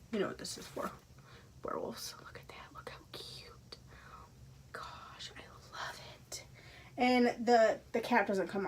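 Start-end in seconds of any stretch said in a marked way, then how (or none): 0.1-6.4 hushed unvoiced whisper; awed whisper to the camera. Real, not acted. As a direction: breathe it softly in awe. Whispering over a cute find.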